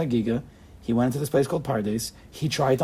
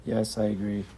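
mock indifference; 4 semitones lower, 4 dB quieter than usual. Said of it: "And you'd say".

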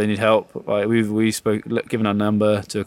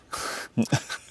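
laugh; 9 dB quieter than usual. Real, not acted. Laughing mid impression.

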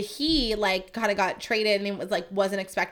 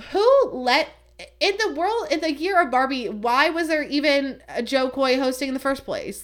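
high-pitched, halting incredulous question; "who let" hit loud, then a broken rant; indignant disbelief; 5 semitones higher, 4 dB louder than usual. Real, not acted. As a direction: demand to know, pitched up and exasperated. Indignant rant about the host.

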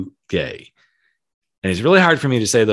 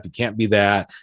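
normal talking voice

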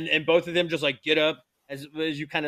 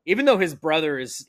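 ordinary talk, nothing marked

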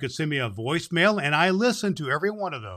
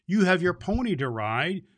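usual speaking voice

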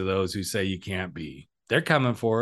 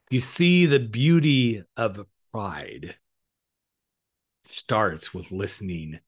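ordinary talk

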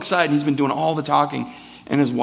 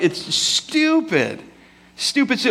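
mock disdain; 11 semitones higher, slower than usual. Real, not acted. Mocking bad listening habits.

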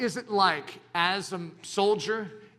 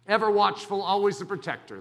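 normal talking voice

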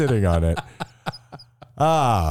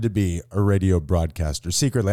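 deep radio broadcaster voice; 9 semitones lower than usual. Shown as a demonstration. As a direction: speak like an AM radio host. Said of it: "a serious radio voice".

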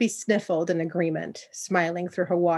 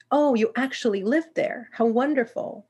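wide-eyed wonder; 6 semitones higher than usual. Acted. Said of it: "her eyes growing wide and curious".

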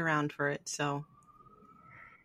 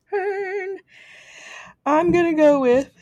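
excited announcer; 11 semitones higher, 10 dB louder, slower than usual. Real, not acted. Playful announcing own pick.

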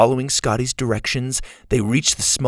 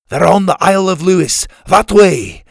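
loud, high urgent voice; calling out directions; 7 semitones higher, 8 dB louder than usual. A narrator's reading. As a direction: shout the way over the noise. Scotty calls out directions.

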